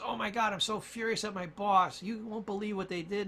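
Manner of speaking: high-pitched, exasperated vent; acted furious venting. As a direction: play someone fuming about the boss. Acted furious friend venting about boss.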